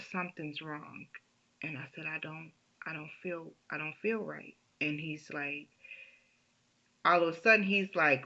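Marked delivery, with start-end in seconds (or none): 0.1-4.6 much softer, weak words; faint, sick voice. Retold or imitated. Patient weakly telling her doctor something's wrong. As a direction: let the illness drain the voice.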